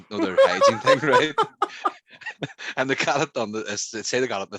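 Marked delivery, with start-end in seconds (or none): 0.2-1.4 high, loud laugh through the words; real laughter breaking through. Real, not acted. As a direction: burst out laughing as you say it. Laughing while telling hygiene rating.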